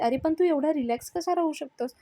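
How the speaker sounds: retold indignant questioning; exasperated question. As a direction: challenge his calm with mounting exasperation. Avni exasperated at Raja's calm.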